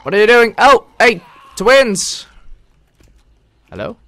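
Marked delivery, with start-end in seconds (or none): 0.0-2.2 loud, high, excited calling out; thrilled shouting at the twins; excited yelling. Real, not acted. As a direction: holler at the incoming pair. Excitedly hails approaching zombies.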